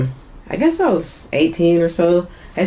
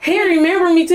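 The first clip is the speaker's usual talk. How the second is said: usual speaking voice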